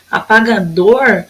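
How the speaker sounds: "Apagador" slowed, weight on "dor"; measured "Apagador", ending on a stressed "dor"; stressed last syllable. Shown as a demonstration. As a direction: lengthen the "dor" of "Apagador" a little. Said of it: "foi a dor".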